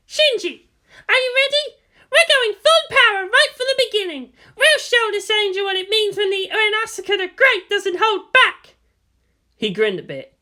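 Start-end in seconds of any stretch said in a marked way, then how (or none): 0.1-8.7 high, loud, cocky boast; Asuka, brash. A narrator's reading. Asuka to Shinji before launch, high and brash. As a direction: show off before the fight.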